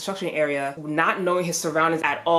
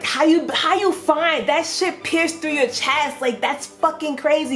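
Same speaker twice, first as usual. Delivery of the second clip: retold alarm; 9 semitones higher than usual. Hero to the pierced stranger, alarmed. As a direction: pitch right up and urge him to get help.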